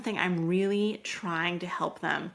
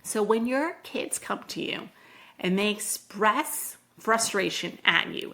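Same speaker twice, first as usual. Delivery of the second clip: ordinary delivery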